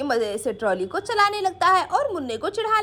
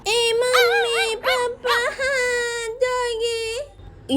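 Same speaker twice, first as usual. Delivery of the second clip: put-on high, drawn-out child's whine; wailing toddler; 4 semitones higher, much slower than usual. Munna calls his parents as dog barks, wailing toddler. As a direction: stretch the words like a frightened small child.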